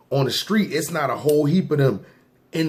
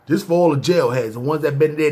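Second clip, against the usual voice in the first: ordinary talk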